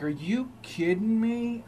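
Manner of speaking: slow disbelieving question; high, slow, incredulous; disbelief. Retold or imitated. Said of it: "I'm like".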